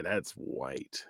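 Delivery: ordinary talk, nothing marked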